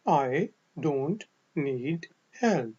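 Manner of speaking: spaced out word by word, very slow; slow repeat-after-me. Shown as a demonstration. Teacher has learners repeat along, slow word-by-word. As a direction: model each word separately for learners to copy.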